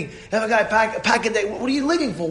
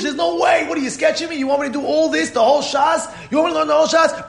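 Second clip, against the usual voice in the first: high, protesting disbelief; incredulous son; 6 semitones higher than usual. Acted. A son protesting his father's blessing in disbelief, voiced high. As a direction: balk like an overwhelmed son.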